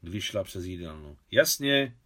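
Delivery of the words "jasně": moderately raised, higher voice; short, louder shout back; called back. A narrator's reading. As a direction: call your agreement after her. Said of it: "křikla za ní Maria".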